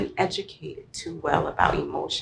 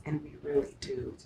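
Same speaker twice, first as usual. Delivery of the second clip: ordinary talk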